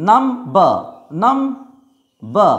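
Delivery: নাম বা in two separate syllables, b sounded; syllable split. Shown as a demonstration. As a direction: break between নাম and বা, starting the second on b. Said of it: "syllable যদি আমরা ভাগ করি".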